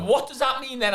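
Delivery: high, puzzled question; a wife confused by the news. Retold or imitated. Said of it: "She went".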